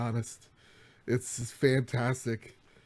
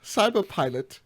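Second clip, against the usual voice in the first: higher, louder mock German accent all through; hammed-up German accent; 8 semitones higher, 7 dB louder than usual. Host pitching the game in a hammed-up German accent. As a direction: play up a cartoon German accent. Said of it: "we're gonna do the accent".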